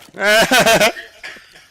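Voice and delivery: loud burst of laughter; laughing. Real, not acted. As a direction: crack up laughing. Laughing during the game.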